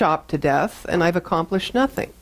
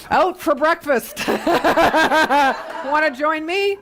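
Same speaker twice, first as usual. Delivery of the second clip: giggling high; laughing; 9 semitones higher than usual. Real, not acted. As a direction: laugh while joking. Laughing joke to audience.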